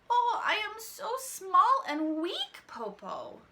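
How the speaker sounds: high, slow, put-on feeble voice; feigned weakness. A narrator's reading. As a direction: pretend to be small and helpless. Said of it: "Shang pretended".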